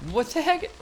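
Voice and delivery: a sudden high-pitched yelp of surprise; surprised what the heck. Real, not acted. A gamer startled by something on screen, blurting in high surprise. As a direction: caught off guard by a new enemy, squeak it out.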